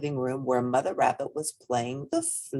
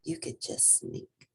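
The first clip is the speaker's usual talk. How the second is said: soft secretive hush; conspiratorial whisper; 10 dB quieter, slower than usual. A narrator's reading. Little Rabbit whispers secret.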